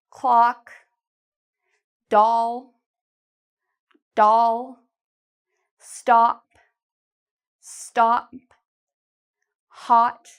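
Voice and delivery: each word with a wide open ah, evenly spaced; ah words to repeat. Shown as a demonstration. Teacher drilling ah words for learners to repeat. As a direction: model each word, leave a gap. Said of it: "repeat after me".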